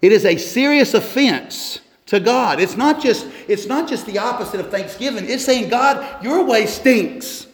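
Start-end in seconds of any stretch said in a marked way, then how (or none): 5.7-7.5 high-pitched, drawn-out griping at God; exaggerated grumbler's protest; mock complaint. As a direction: whine it slowly, pitched well up. Voices a complainer scolding God.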